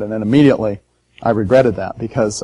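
ordinary talk

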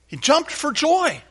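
high, slow, joyful exclamation; jubilant, drawn-out cry; exuberant. Acted. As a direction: stretch it out high with delight. Exuberant version of leapt for joy.

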